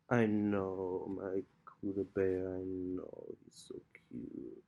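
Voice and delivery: hushed, breathy murmurs to the kitten; whispering to cat. Real, not acted. Owner soothing her cuddling kitten in a whisper.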